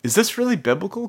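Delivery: high, sceptical questioning of the Bible; incredulous doubt. Retold or imitated. Doubter questioning whether this is biblical, incredulous.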